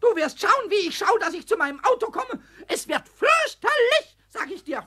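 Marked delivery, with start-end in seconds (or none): 2.7-4.2 high, rising threat on fürchterlich; threatening. Acted. Pumuckl threatens Meister Eder, fuming. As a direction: promise something terrible.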